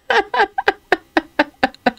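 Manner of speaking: big real laugh, pitched high; high burst of laughter. Real, not acted. (grand éclat de rire aigu)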